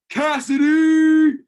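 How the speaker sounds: loud, high, drawn-out yell of a name; long shouted call. Retold or imitated. Fan hollering at a wrestler from afar, high, loud and slow. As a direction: stretch the name out at full voice. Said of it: "to where he could see it".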